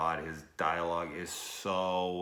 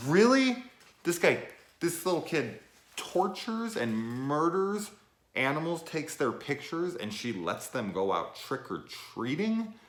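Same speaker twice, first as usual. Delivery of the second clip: much higher and louder, incredulous; can you believe this, aghast; outraged disbelief; 10 semitones higher, 5 dB louder than usual. Real, not acted. Reviewer appalled that the mom lets him go out, outraged disbelief.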